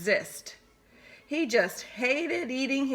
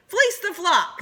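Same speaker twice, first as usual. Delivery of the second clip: high, louder snicker; 10 semitones higher, 5 dB louder than usual. Acted. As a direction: snicker it gleefully. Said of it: "they both snickered".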